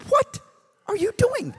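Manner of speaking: sudden high alarmed cry. Retold or imitated. Preacher alarmed as the driver prays eyes closed, shrieking high. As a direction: shriek it in disbelief.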